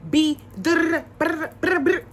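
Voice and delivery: loud, high rolled brrs; mocking "brr" noises. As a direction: mimic him with loud trilled noises. Mimicking how that dude talks.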